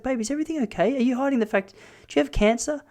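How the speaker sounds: anxious wife begging him to tell; worried wife. Retold or imitated. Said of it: "And she's like".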